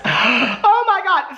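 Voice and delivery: sharp gasp, then a high, drawn-out "oh my God"; mock shock. Real, not acted. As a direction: gasp like the age of the book is scandalous. Realizing the book is nineteen years old.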